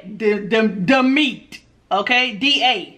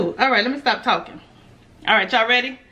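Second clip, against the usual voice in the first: usual speaking voice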